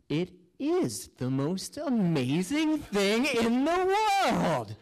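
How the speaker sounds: slow, rising swell to a high, drawn-out finish; awestruck wonder. Acted. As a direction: marvel at it, stretching and lifting every word. Acting out sudden wonder at ceiling.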